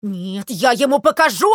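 loud, indignant threat; acted breathless rage; furious yelling. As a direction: bellow it like an enraged little sparrow. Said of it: "орал Воробей Воробеич, задыхаясь от ярости".

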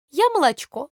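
lightly voiced milk, a bit high; the little milk pot announcing itself. A narrator's reading. The milk introducing itself in the pot, lightly voiced.

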